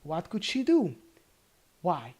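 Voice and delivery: ordinary delivery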